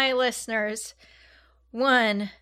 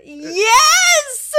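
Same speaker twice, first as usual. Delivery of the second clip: anguished yell; 9 dB louder than usual. Real, not acted. Dreading drinking it all.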